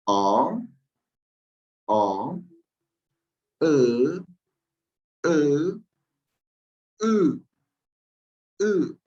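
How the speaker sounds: teacher modeling Ngoberé umlaut vowels to students; umlaut vowel drill. Shown as a demonstration. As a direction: model the three vowels for the class to repeat. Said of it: "vamos a pronunciar nuevamente estas tres últimas vocales".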